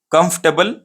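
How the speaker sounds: "कंफर्टेबल" shortened to KUMF-tuh-bul; right pronunciation. Shown as a demonstration. Teacher tells what it is called, "कंफर्टेबल" as kumf-tuh-bul. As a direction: drop the "er", reduced ending in "कंफर्टेबल". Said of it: "ये right pronunciation है".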